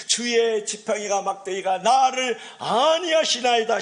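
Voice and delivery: high, loud, drawn-out psalm proclamation; scripture recitation. Acted. Preacher repeating the psalm for the congregation, loud slow recitation. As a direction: declaim the verse slowly and forcefully.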